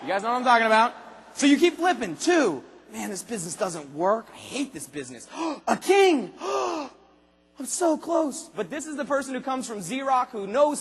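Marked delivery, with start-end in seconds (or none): 2.9-5.3 low, softer moping grumble; grumbling, dropping low and quiet; mock gloom. Discouraged recruit voiced. 5.5-6.4 higher gasp of surprise; sharp, excited intake of breath. Acted. Gasp at drawing king.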